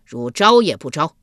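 a loud, barking shout at the prisoner; shouted demand. Acted. Guard shouting at Yulian to confess, loud and harsh. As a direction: roar the question like a harsh guard. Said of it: "侍卫喝道".

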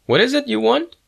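high-pitched, demanding question; demanding clerk. A narrator's reading. Sales clerk to a puzzled student customer, high-pitched demand. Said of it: "the sales clerk demanded".

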